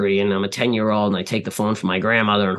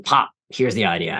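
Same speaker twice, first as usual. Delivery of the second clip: ordinary delivery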